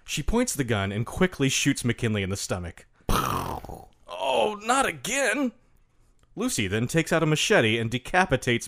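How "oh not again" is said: high, strained wail; pained old man cry. Acted. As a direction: cry out as you're shot. McKinley shot, cries out.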